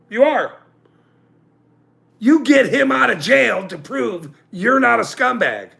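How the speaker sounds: ordinary delivery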